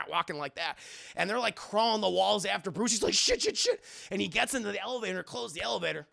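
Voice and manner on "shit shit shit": high panicked cursing. Retold or imitated. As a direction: panic as they chase you. Batman panicking.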